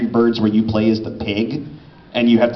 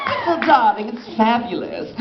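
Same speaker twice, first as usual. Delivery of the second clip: flamboyant Kuranosuke; 10 semitones higher than usual. Acted. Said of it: "Kurenosuke and Princess Jellyfish".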